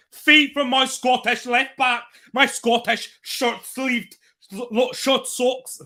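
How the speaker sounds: putting on a bad Scottish accent for the left back; mock Scottish accent. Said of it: "That's a shocking accent".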